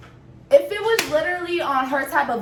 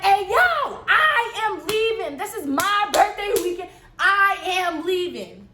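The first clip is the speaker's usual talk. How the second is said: retold yell; 7 semitones higher, slower than usual. Yelling at friend in parking lot.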